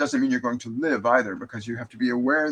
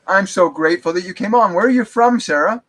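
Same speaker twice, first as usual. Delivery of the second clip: high, loud, beaming hello; excited greeting; 8 semitones higher, 10 dB louder than usual. Real, not acted. Medium welcomes Sarah onto the live stream, high and loud with excitement. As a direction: greet her like a long-lost friend.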